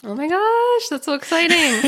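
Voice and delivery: high, thrilled gush; excited squeal. Real, not acted. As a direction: squeal with real delight. Hearing she is 300th guest.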